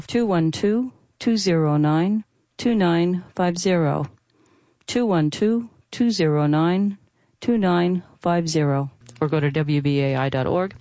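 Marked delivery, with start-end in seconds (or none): 0.0-8.9 slow, measured digits in small groups; slow phone number readout. Real, not acted. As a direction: spell out the number slowly for listeners to write down. Reads pledge phone number twice.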